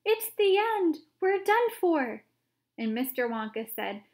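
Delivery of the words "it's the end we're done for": very high, loud, panicked cries; panicked old man yelling; terrified shout. A narrator's reading. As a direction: scream for help as the roof smashes. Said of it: "Grandpa Joe shouted".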